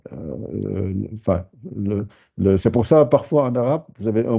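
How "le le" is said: ordinary delivery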